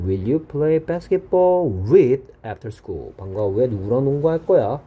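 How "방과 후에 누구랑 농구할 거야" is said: ordinary delivery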